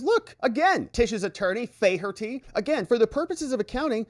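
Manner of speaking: high, exasperated whiny witness; mock Don Junior.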